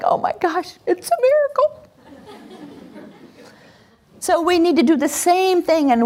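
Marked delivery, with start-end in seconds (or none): 0.0-1.7 high, delighted gasp of wonder; amazed parent. Retold or imitated. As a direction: parent marveling at a miracle. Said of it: "we feel like".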